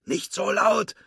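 high, strained, urgent begging; tense shushing plea. A narrator's reading. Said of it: "bat Woody".

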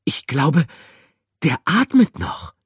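high, loud relieved call; relieved cry. Acted. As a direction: burst out with relief. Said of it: "rief sie erleichtert".

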